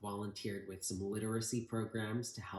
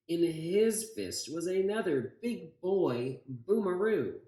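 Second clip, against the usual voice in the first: put-on lively read-aloud for children, higher and louder; story reading; 3 semitones higher, 6 dB louder than usual. A narrator's reading. Teacher reading The Butter Battle Book to the class, lively read-aloud.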